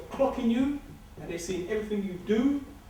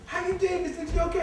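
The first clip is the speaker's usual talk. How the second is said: lightly voiced polite teller, higher; a hint of a sweet bank clerk greeting; 8 semitones higher than usual. Bank woman greeting the speaker, lightly higher and sweet. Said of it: "she's like".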